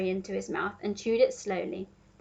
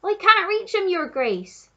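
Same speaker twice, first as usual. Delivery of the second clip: raised, higher voice calling down; gardener calling back; 9 semitones higher, 8 dB louder, slower than usual. A narrator's reading. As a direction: call back to his master from up a ladder. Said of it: "the gardener called back".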